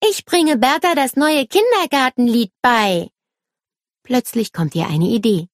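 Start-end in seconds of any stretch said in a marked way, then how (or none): 0.0-3.1 put-on high, indignant little-girl voice. A narrator's reading. Nele justifying her song to David, indignant. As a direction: defend yourself in a raised child's pitch. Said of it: "Nele guckt David empört an".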